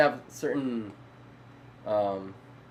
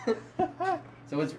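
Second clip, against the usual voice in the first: stretched slow and high through laughter; can't get the words out for laughing; 4 dB quieter, much slower than usual. Real, not acted. Laughs at his own teasing.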